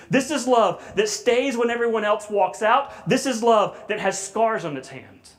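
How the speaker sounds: preaching at full force. Acted. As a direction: preach it with mounting fervor.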